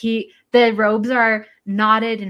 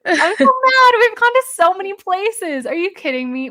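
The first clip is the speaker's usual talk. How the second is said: much higher and louder, theatrical mock fury; hammed-up outrage; 11 semitones higher, 8 dB louder than usual. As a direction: overplay the indignation for laughs. Mock outrage, musical style.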